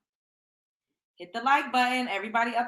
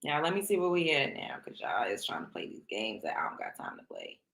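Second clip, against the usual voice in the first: hushed, breathy whisper, much softer; 13 semitones lower, 7 dB quieter than usual. Acted. Teasing viewers in a low sneaky whisper. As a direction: drop to a secret whisper. Whispers then shouts same line.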